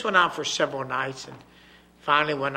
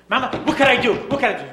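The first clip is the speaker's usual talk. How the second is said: put-on high, loud eager child; eager little girl; 9 semitones higher, 11 dB louder, faster than usual. Little girl asks mama for chores, high and eager. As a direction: bounce in as an excited child. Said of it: "the little girl says".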